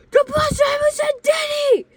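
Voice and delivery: very high, loud crowd shriek; freaking out. Retold or imitated. Whole bus reacting to the driver, excited shrieking. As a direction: scream it in thrilled disbelief. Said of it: "freaking out".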